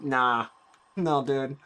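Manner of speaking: ordinary talk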